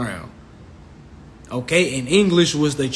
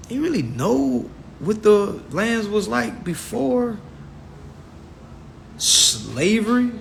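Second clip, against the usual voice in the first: probing challenge; 7 semitones higher, 5 dB quieter than usual. Retold or imitated. Challenging people who claim royal history, sceptical probing.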